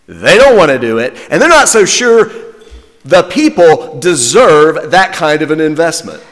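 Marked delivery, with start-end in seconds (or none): none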